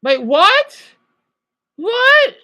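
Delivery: high, loud shocked disbelief. Real, not acted. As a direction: jolt up in disbelief. Shocked at what he hears.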